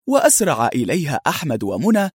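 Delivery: usual speaking voice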